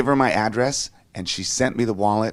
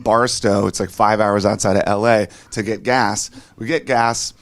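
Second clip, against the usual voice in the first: usual speaking voice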